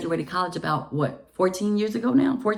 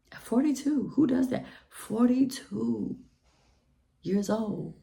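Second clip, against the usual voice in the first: higher, softer disbelief at forty-two; incredulous; 5 semitones higher, 4 dB quieter than usual. Real, not acted. Mocking the man's age in disbelief. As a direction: scoff at the age.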